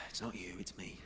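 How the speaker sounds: mock breakup line, nearly whispered; sigh, then say it very softly under your breath; soft, low-energy joking aside; hushed aside.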